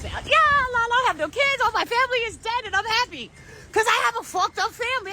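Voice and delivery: high, singsong show of glee; mock carefree happy. Shown as a demonstration. Showing how they dance down the street all day, high carefree glee. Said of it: "Like, I'm like this all day".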